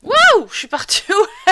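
high, loud surprised wow. Real, not acted. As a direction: blurt it out, caught off guard. Sudden teleport in game.

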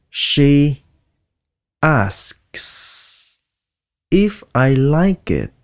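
slow careful "She asks if I like it" with every sound; 1 full careful reading. Shown as a demonstration. Teacher reading to learners, each word separate. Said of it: "Các bạn nói".

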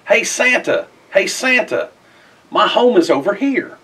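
high, loud eager call to the sky; child shouting up at a passing sleigh, thrilled; excited calling out. A narrator's reading. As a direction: call out to Santa, waving and hopeful.

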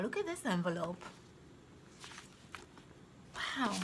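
ordinary talk, nothing marked

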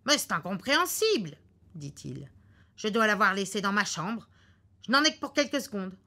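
husband baffled and hurried; bewildered husband. Acted. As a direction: insist on going back. Husband insists on going back.

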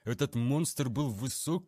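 ordinary delivery

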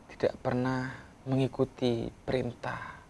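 ordinary talk, nothing marked

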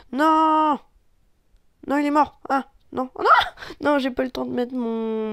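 high loud cries of no; dismayed shout. Real, not acted. Shouting no as his teammate dies. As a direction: wail no at the screen.

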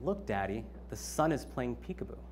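softly quoted small son; very quiet. Retold or imitated. Father retelling his son's remark from the back seat, very quietly. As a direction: say it softly, lost in wonder. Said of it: "he says very quietly".

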